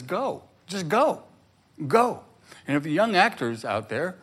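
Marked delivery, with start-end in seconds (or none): 0.0-2.2 high, slow coaxing go rising higher; a hint of an encouraging cheer; urging inner voice. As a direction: coax them on, building up. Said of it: "support system that says".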